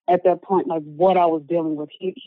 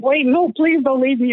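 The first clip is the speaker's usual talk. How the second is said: higher, urgent pleading; mildly panicked plea, pitched up; retold frantic plea; 8 semitones higher, 3 dB louder than usual. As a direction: re-enact begging someone to stay. Acting out her panicked plea.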